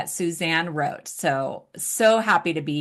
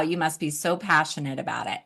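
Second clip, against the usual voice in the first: ordinary talk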